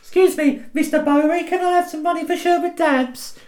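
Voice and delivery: high, pleading street urchin; child urchin voice. Acted. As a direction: beg like a little Victorian urchin. Urchin begging Lee Bowery for money.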